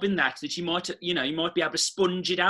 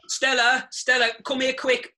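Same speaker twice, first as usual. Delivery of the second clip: calling out; 6 semitones higher, 3 dB louder than usual. Acted. Richie calls Stella from kitchen.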